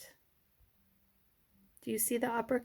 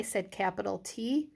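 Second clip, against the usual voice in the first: usual speaking voice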